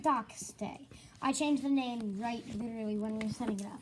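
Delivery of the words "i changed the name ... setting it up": hushed aside; whispering. Real, not acted. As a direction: whisper so the mic barely catches it. Whispering while camera rolls.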